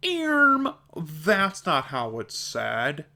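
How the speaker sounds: mock a nitpicker butting in; pedantic correction. People correcting the speaker's words, mocking know-it-all voice. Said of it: "I have people correcting me".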